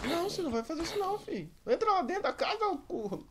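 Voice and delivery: high furious scolding; angry yelling. Real, not acted. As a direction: yell at the mob to stop. Yelling at zombie in village.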